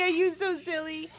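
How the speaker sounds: normal talking voice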